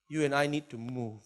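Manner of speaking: ordinary talk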